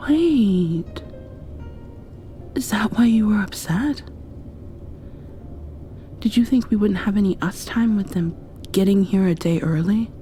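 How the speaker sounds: ordinary talk, nothing marked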